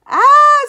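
very loud, high scream; audience scream. A narrator's reading. Audience scared by the ghost, loud high screaming. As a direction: shriek out in fright. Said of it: "screamed the audience again".

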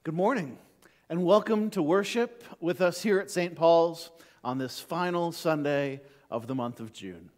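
normal talking voice

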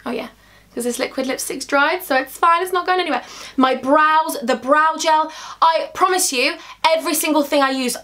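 ordinary delivery

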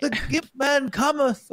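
high, slow theatrical proclamation; mock olden herald. Host playing a villager warning of Gimp Man, mock-archaic dread. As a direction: draw it out as a hammed-up portent of doom.

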